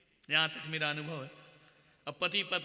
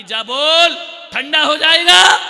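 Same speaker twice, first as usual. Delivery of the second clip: high, very loud holler; shouted nagging; 8 semitones higher, 16 dB louder than usual. Acted. Mother sending son back to papa, shouting. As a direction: yell it across the house.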